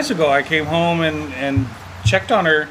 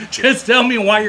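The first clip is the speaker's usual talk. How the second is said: higher, laughing exasperated push; frustrated parent; 7 semitones higher, 4 dB louder, slower than usual. Retold or imitated. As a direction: lose patience with a laugh. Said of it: "I'm getting frustrated".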